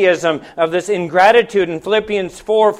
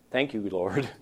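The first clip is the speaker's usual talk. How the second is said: hushed, much softer and lower thanks; quiet prayer; 11 semitones lower, 13 dB quieter than usual. Real, not acted. Preacher pausing in the sermon to thank God, hushed. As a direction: murmur it as a private prayer.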